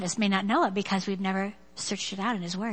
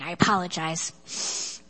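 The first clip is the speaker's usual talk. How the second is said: ordinary talk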